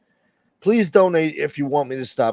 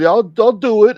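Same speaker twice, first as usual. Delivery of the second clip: quote; 9 semitones higher, 10 dB louder than usual. Retold or imitated. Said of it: "He said".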